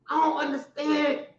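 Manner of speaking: ordinary talk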